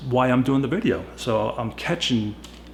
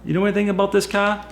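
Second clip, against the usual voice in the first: friendly phone voice; 7 semitones higher than usual. Retold or imitated. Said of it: "I said".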